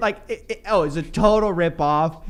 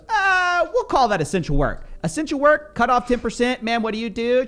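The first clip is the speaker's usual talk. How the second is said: pushy salesman; 7 semitones higher than usual. Retold or imitated. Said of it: "And he goes".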